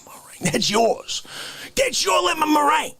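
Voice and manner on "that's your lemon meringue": excited shout. Acted. Excited about last meringue.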